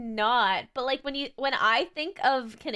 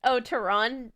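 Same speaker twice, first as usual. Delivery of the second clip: normal talking voice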